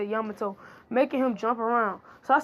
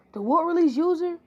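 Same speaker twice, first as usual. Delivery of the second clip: surprised; 7 semitones higher, 5 dB louder than usual. Acted. Naruto surprised at Yamato.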